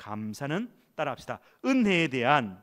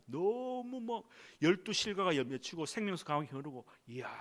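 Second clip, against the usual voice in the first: mock wonder; 4 semitones higher, 6 dB quieter than usual. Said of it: "천국에 가보니까".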